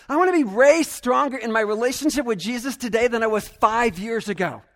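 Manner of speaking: ordinary delivery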